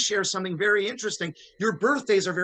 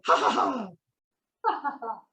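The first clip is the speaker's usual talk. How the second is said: put-on ha-ha-ha; mock laugh; 3 semitones higher, much slower than usual. Shopkeeper laughing at poor wizard, put-on laugh. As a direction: fake a haughty shopkeeper's laugh. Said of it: "The woman laughed".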